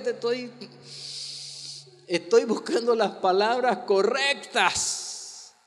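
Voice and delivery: slow, gentle, measured; calm searching words. Shown as a demonstration. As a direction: speak slowly, searching for the right words. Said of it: "haga lo que el pastor David diga".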